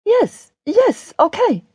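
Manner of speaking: very high, hurried-off agreement; flustered Daria, pitched right up. A narrator's reading. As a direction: agree distractedly in a high character voice.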